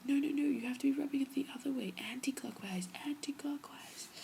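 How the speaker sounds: high, fast urgent cousin. Retold or imitated. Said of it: "she's like".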